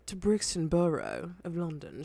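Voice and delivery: put-on low, quiet London accent; British accent. Teasing a British friend, in a London accent. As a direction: drop into a soft put-on British voice.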